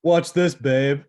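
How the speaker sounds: loud, high show-off call; cocky boast. Retold or imitated. Showing off to his wife on the boat, a loud cocky call. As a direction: brag to your wife before the cast.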